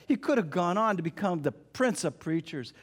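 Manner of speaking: normal talking voice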